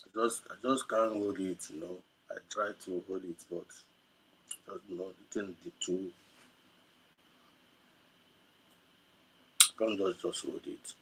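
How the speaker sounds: holding back tears, barely audible; fighting to hold back tears; choked up. Real, not acted. Overcome while speaking of the dead, choking up. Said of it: "I'm very emotional over this".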